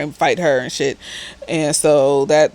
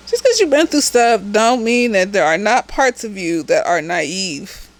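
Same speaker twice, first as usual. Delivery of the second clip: ordinary talk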